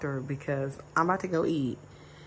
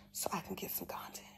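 softer, whispered aside; quick soft whisper to the camera; 3 semitones higher, 12 dB quieter, faster than usual. Real, not acted. Morning talk, drops her voice.

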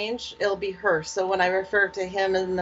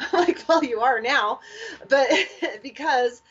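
laughing; 6 semitones higher than usual. Real, not acted. Laughing at funny story.

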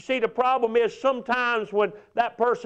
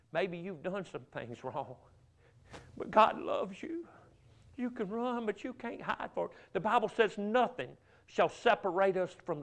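soft, low preaching, gently reassuring; hushed assurance of being loved; tender appeal; 5 semitones lower, 9 dB quieter than usual. Real, not acted. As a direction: bring it right down to comfort the hearer. Tender appeal about God's love.

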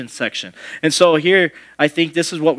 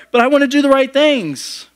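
put-on high pleading kid voice; whiny little kid; 9 semitones higher, 4 dB louder than usual. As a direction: plead like a child in trouble. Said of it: "you know, like".

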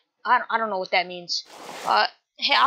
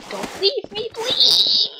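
high wail building to a loud please; frantic, high-pitched plea; desperate begging; 11 semitones higher, 4 dB louder, much slower than usual. Acted. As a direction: plead in a high squeal, louder at the end. Begging, escalated take.

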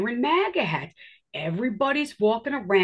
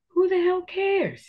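high, slow, scoffing who the hell cares; mock-exasperated high scoff; dismissive retort; 10 semitones higher than usual. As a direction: brush the question off with disbelief. Retorting to Trump talk.

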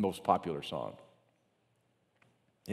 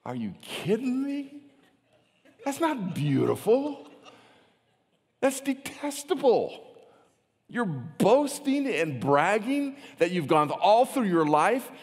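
high, drawn-out incredulous rebuke, growing louder; hammed-up scolding in a raised pitch; indignant James; 8 semitones higher, 4 dB louder, slower than usual. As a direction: act out appalled disbelief, stretching the key words. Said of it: "and James goes".

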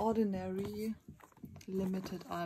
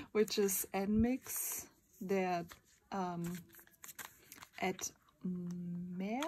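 ordinary talk, nothing marked

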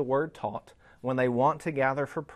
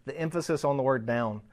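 usual speaking voice

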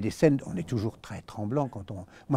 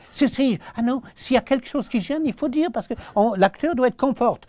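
loud, high, insistent assistant; eager insisting; 11 semitones higher, 7 dB louder than usual. Retold or imitated. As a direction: press the point loud and high, all eager. (insistance empressée, voix haute et forte)

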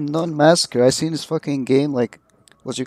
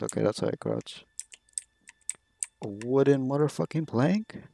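ordinary talk, nothing marked

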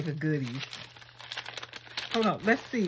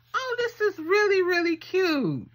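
excited squeal; 13 semitones higher, 4 dB louder than usual. Real, not acted. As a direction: gush over the gift like it's the cutest thing ever. Gushing over handmade gift.

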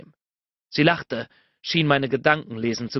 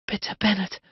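begging; 6 semitones higher than usual. A narrator's reading. Narrator voices a woman begging her lover, pleading. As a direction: beg in a higher, acted voice. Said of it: "bettelte sie".

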